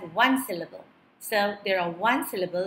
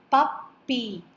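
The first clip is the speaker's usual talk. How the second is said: "puppy" split as pup, py; syllable by syllable. Shown as a demonstration. Teacher dividing the word, "puppy" in two beats. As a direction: pause between the halves of "puppy". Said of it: "We are again dividing the word".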